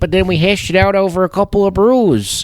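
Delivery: cheery local telling his story; Fargo accent. Retold or imitated. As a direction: neighbor chatting in a mock Fargo accent. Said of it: "Little Fargo".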